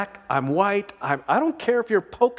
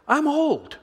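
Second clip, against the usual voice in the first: whiny excuse; 9 semitones higher than usual. Retold or imitated. Voicing the doubter's excuse.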